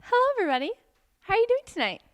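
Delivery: high, bright welcome; cheery server greeting. Acted. Server welcoming a table, high and cheerful. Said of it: "I said".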